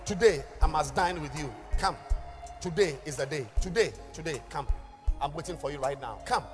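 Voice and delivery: high, loud booming altar call; booming motivational speech. Acted. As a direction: call them up with urgency. Altar call over the music.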